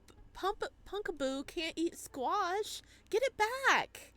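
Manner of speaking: urgent call, rising high; urgent character voice. Acted. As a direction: hurry them to fetch it back.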